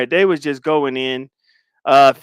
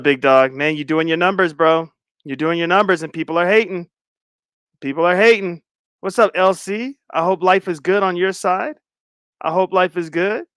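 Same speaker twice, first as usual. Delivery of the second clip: ordinary delivery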